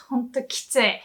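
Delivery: worn-out salaryman grumble; drained complaint after a long day; exhausted sigh. Shown as a demonstration. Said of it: "Complain like this and you will sound like an exhausted Japanese salaryman".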